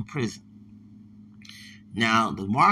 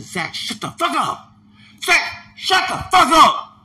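building angry yell, rising much higher and louder; Mark furious; 10 dB louder, slower than usual. Retold or imitated. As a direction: scream it at Zach, losing control. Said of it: "I never seen Mark that angry".

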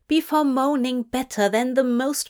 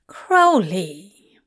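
sighed; 2 semitones lower, 4 dB louder than usual. A narrator's reading. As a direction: breathe the name out with a sigh. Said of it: "Zira sighed".

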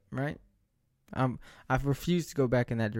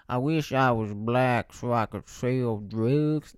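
slow, drawn-out imitation of the guy; slow mock imitation of a stranger; mocking his delivery; 4 dB louder, slower than usual. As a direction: stretch each word like he did. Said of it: "That's how he said it".

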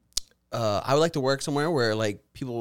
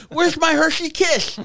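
high, loud, drawn-out mock complaint; mock outraged whine; 8 dB louder, slower than usual. Podcaster imitating an irate coworker over missing candy, mock outrage. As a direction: exaggerate a petty tantrum, pitched way up.

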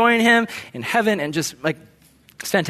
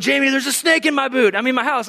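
higher, urgent mock alarm; mildly acted panic; 8 semitones higher than usual. Preacher re-enacting his call to Jamie, mock panic. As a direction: blurt it out in mock fright.

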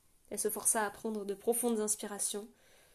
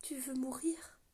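a soft, breaking whisper; broken voice; 4 semitones higher, 4 dB quieter, slower than usual. A narrator's reading. Ruya asking Éther if she wants to die, in a broken whisper. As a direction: whisper it as if the voice cracks. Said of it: "la voix brisée de Ruya".

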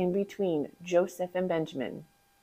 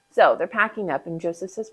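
ordinary delivery

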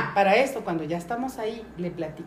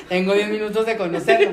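laughing quote; 2 semitones higher, 8 dB louder than usual. Retold or imitated. Retelling meeting a stranger, laughing.

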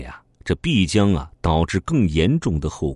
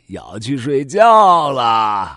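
sleepy; 6 semitones higher, 7 dB louder, much slower than usual. Acted. Uncle signing off for bed.

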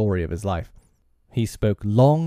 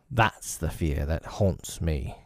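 haunted, quiet; 4 semitones lower, 6 dB quieter, slower than usual. Acted. Hook fearful, quiet.

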